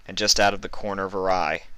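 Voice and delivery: ordinary delivery, nothing marked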